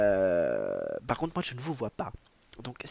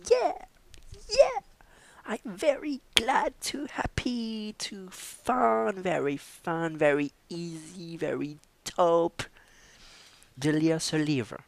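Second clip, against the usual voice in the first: high put-on English voice, whispered at first, then louder; hammed-up English speaker; 7 semitones higher, 6 dB louder, slower than usual. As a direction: play a showy English host, breathy then bold.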